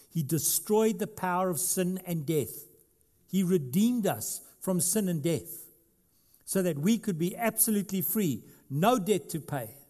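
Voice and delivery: preaching at full force. Acted. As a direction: proclaim it with rising conviction. Preaching builds on redemption.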